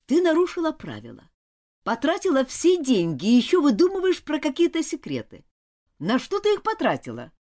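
high, loud accusation; cross, forceful telling-off; angry. Acted. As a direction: scold her and demand an answer. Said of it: "Нора начала злиться".

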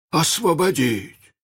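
высоко и протяжно, не веря; переспроси приказ в изумлении; изумлённое переспрашивание. A narrator's reading. Пираты поражены приказом. (крайнее удивление)

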